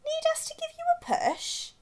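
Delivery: tiny high whispered fish voice; silverfish. A narrator's reading. Silverfish offering to push the iceberg, tiny high whisper.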